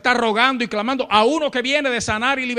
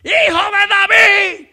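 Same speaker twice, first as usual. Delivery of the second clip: high, loud, desperate shouted plea; crying out after someone; 12 semitones higher, 8 dB louder than usual. Retold or imitated. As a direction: persistent woman yelling for mercy at full voice. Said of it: "dando voces detrás del Señor".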